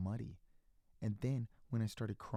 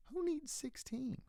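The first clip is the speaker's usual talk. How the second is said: indignant scoff. Acted. Child scoffs at teacher.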